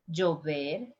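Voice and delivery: llover as "jover"; voiced j pronunciation. Shown as a demonstration. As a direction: start llover on a buzzed j. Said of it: "with the three different forms".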